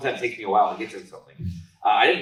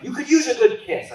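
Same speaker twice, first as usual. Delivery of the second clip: movie line voice; 7 dB louder than usual. Retold or imitated. Quoting a funny movie line.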